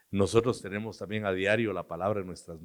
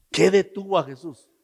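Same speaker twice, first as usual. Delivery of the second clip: ordinary talk, nothing marked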